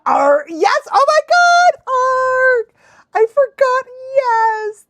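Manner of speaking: high, loud squeal of delight; excited recognition. Real, not acted. Fan suddenly remembering Ark, thrilled squeal. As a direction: burst out as the memory hits.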